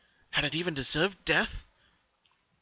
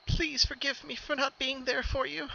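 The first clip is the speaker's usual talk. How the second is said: high, teary plea for forgiveness; grieving pony begs her lost pet, high and tearful; shaking with tears; 11 semitones higher, 6 dB quieter than usual. A narrator's reading. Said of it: "she said as her shoulders shook".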